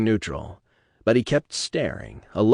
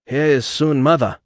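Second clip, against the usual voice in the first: a hint of a clinical scientist's accent; lightly accented Suchong; 5 semitones higher, 6 dB louder than usual. A narrator's reading. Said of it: "as pronounced as Suchong's".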